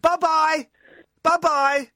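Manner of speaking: high, loud sing-song goodbye; a mocking bye-bye called after the fleeing biker; taunting farewell. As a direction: wave him off with a cheeky taunt.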